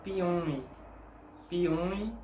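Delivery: "Piumhi" said pee-oon-ee, nasal u, silent h; "Piumhi" with the m nasalizing the u and h silent; Portuguese pronunciation. Shown as a demonstration. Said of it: "la palabra".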